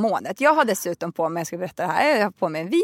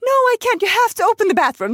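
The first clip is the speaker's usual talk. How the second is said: much higher, louder, rushed begging; desperate plea; 10 semitones higher, 5 dB louder, faster than usual. Retold or imitated. Passenger begging the attendant to open the toilet, high and desperate. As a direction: beg like you are about to cry. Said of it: "för att jag nästan gråter".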